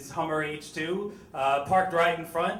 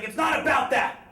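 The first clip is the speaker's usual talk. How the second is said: much higher, louder mock shout; raging shout; 10 semitones higher, 7 dB louder than usual. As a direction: bark it like a man in a rage. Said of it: "was in a rage".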